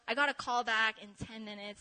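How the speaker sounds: ordinary talk, nothing marked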